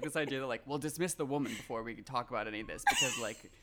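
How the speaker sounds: ordinary delivery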